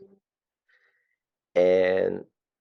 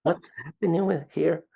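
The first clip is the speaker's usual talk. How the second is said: higher-pitched mock bewilderment; baffled homeowners; 7 semitones higher than usual. Homeowners puzzled by the salesman's accent, mock-baffled. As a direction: puzzle over a foreigner's patter. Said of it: "they would look at me like".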